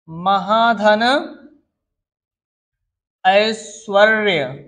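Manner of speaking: slow dictation. Shown as a demonstration. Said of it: "teacher dictating word".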